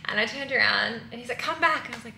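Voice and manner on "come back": higher, louder call of come back; lightly imitated man calling out; mock call-out. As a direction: call her back once more, playfully. Guy calling her back again.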